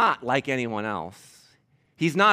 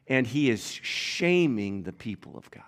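hushed; 3 semitones lower, 4 dB quieter than usual. Real, not acted. Preacher stresses shame.

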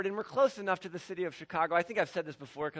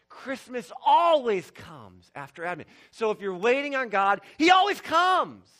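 loud, rousing preacher voice building to a shout on always; preaching at full force; 6 semitones higher, 9 dB louder than usual. Acted. Pastor preaching to his congregation, loud and rousing. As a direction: proclaim it to the whole church.

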